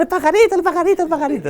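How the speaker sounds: put-on high, fast excited kids' clamor; excited children. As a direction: cry out high like kids spotting a bird. Children rushing to see bird.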